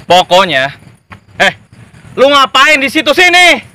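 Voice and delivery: loud thug barking orders; bully your men into obeying; bullying boss. Acted. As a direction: gang boss to henchmen, loud and bossy. Gang boss bullies henchmen.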